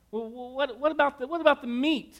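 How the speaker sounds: high put-on boy's voice; squeaky eager kid asking; excited kid. Son asks mom about the meat in a high kid voice.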